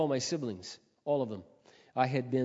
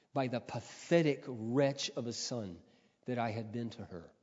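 ordinary talk

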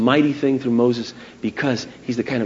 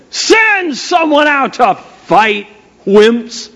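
high, drawn-out giant's challenge; taunting mock yell; 9 semitones higher, much slower than usual. Giant challenges the frightened army, acted taunting yell. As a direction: hurl a slow, mocking call across the field. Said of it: "he's yelling at him".

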